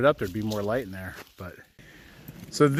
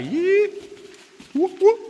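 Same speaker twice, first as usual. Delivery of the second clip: yeet and whoop, way up high; high cheering whoops; slower than usual. Real, not acted. Cheering the dogs through the tunnel, way up high.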